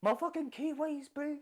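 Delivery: high-pitched mock Kiwi accent. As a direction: ham up a New Zealand bro. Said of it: "I've been doing this accent".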